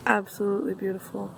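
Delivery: usual speaking voice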